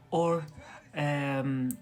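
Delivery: ordinary talk, nothing marked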